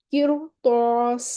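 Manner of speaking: قرطاس drawn out very slowly with a full, heavy ر; heavy ra recitation. Shown as a demonstration. Teacher recites قرطاس for students, slow with heavy ر. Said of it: "تو را کو ہم موٹا ہی پڑھیں گے".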